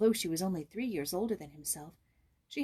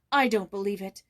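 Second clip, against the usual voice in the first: louder, indignant outburst; girl defending her friend, indignant; indignant cry; 8 dB louder than usual. A narrator's reading. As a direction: stamp it out in protest. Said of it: "she cried indignantly".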